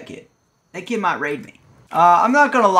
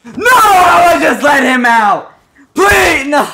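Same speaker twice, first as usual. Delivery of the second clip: panicked scream; 8 semitones higher, 9 dB louder, slower than usual. Real, not acted. Raider in his base.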